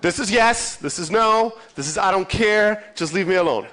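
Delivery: usual speaking voice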